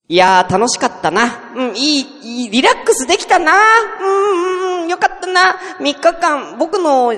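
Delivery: high, drawn-out wail of fake satisfaction; comic, high-pitched self-pity; mock-happy lament. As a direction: gush about it as if close to tears. Sarcastic lament over ruined holiday.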